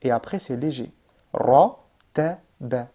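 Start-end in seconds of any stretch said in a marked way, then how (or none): 1.3-2.9 "Ra" heavy and louder, "ta, ba" light; heavy then light. Shown as a demonstration. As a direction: rise on Ra then relax. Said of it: "ça montait et après, ça revenait normal".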